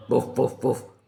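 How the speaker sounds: imitated "woof" barks; short vocal woofs; wolf barks. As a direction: bark three times like an obedient wolf. Wolves greet their master.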